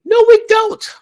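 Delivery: squeaky exasperated no we don't; incredulous retort. Real, not acted. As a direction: fire it back in disbelief. Sharp retort to quoted women.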